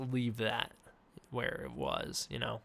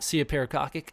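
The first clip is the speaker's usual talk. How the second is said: ordinary delivery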